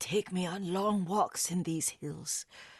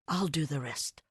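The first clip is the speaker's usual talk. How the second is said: ordinary talk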